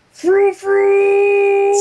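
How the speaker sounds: high, loud battle cry; booming Big Dash cry. Acted. Reader voicing Dash's cry to the friends: loud high yell. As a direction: belt it out as a silly war cry. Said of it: "weirdly deep, booming voice of Big Dash".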